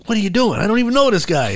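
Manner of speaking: high-pitched mock disbelief; incredulous. Friend objects to housing a stranger, incredulous.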